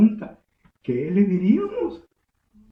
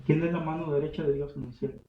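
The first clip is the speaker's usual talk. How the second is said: ordinary talk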